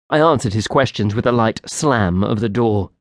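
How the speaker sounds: ordinary delivery, nothing marked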